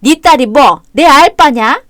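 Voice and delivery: sneering contempt. Acted. Mother-in-law sneering at granddaughter.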